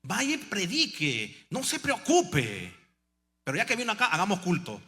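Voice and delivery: the sister scolding the crying pastor, loud and high; sister rallying. Retold or imitated. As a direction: lift the weeping men with a cry. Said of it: "me dice la hermana".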